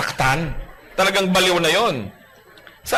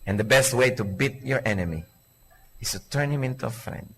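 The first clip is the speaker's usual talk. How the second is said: lower, softer closing line; softened maxim; 5 semitones lower, 7 dB quieter than usual. Acted. Preacher softly repeating the maxim to close the point.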